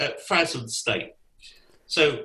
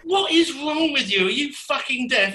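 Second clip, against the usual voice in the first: high, raised exasperated demand; mock-angry, pitched-up demand; 11 semitones higher than usual. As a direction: throw up your hands at him. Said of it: "and we said".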